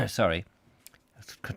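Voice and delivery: ordinary delivery, nothing marked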